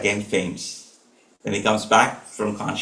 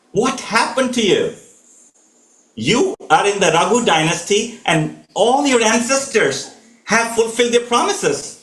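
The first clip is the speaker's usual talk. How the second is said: raised voice; 8 semitones higher than usual. Acted. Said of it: "raises her voice".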